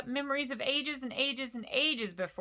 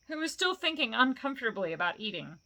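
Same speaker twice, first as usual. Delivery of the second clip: ordinary delivery, nothing marked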